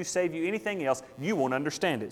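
ordinary talk

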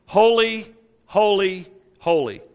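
"Holy, holy, holy" stepping down from high and loud; emphatic triple. Acted. Old Testament writers' triplicate, emphatic "Holy, holy, holy". Preacher demonstrating the triple repetition.

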